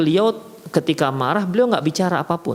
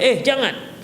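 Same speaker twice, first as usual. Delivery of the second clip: sharp, higher stern "jangan"; firm prohibition; 8 semitones higher, slower than usual. Shown as a demonstration. Teacher stopping a pupil, firm higher "jangan". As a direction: snap out the "jangan" like a strict teacher. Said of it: "melarangnya dengan intonasi yang kuat".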